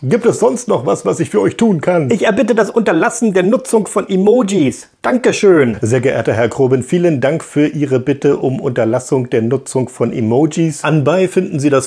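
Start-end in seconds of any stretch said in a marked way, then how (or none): 2.1-5.8 higher stiff official voice; pompous request. Acted. A viewer demanding no emojis in a higher, stiff formal voice.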